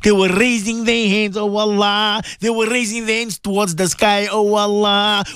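sing-song supplication with long chanted ameens; swaying, chant-like congregational prayer; fervent prayer leading. Real, not acted. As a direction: lead listeners in heartfelt dua, stretching the holy words.

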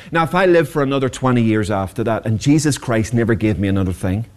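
ordinary delivery

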